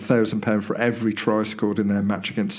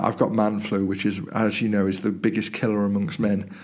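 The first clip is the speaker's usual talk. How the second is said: ordinary delivery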